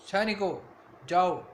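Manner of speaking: loud barked order to the soldiers; royal command. Acted. The foolish king commands his soldiers, loud royal order. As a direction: call the guards with full authority.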